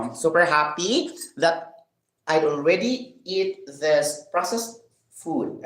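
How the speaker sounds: ordinary talk, nothing marked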